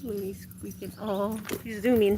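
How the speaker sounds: ordinary talk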